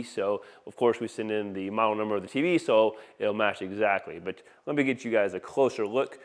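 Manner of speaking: ordinary delivery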